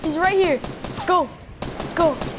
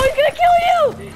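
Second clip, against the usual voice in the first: high, loud cry of alarm; alarmed warning; 10 semitones higher, 4 dB louder than usual. Real, not acted. Warning a teammate about to be killed, alarmed. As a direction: scream a last-second warning.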